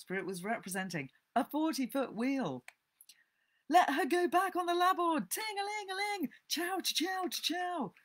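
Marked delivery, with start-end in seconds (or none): 3.7-7.9 high, loud order, then bell and chugging; play-acted captain and engine; boy playing steamboat captain. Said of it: "He was boat and captain and engine bells combined".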